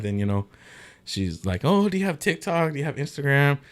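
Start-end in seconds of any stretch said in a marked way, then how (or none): 1.7-3.6 mock girl questions. Imitating the girl asking.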